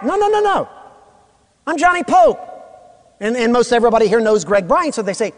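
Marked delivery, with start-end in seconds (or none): 0.0-2.4 high correction. Retold or imitated. Correcting mistaken identity.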